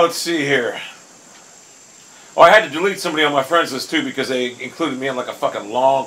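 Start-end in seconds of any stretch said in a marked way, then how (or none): none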